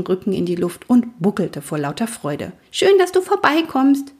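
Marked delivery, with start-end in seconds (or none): 2.7-4.1 very high, delighted welcome; happy foal. A narrator's reading. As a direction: welcome dad in a little foal voice. Overjoyed foal greets father. (freudig und ganz hoch)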